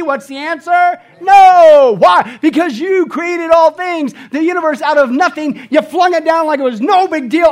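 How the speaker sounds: loud, driving sermon delivery; fervent pulpit proclamation; full-force preaching. Acted. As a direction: preach it hard to the congregation.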